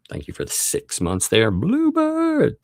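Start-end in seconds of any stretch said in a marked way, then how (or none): 1.5-2.6 very high, drawn-out call; high playful whoop of greeting; playful name call. Pretend. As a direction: sing out the name in a silly high voice. Playfully calling out member's name again.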